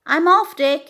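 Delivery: loud, high call from the kitchen door. A narrator's reading. Bobcat calls out to Dickory at the door, loud and high. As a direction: call the goodbye brightly across the house. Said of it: "he called".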